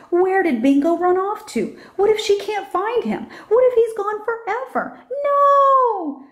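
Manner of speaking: put-on a girl growing frantic about her lost dog; from worried to a high wailing no; rising panic. A narrator's reading. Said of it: "Lulu cries".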